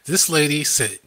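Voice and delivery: ordinary delivery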